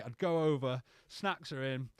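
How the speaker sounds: ordinary delivery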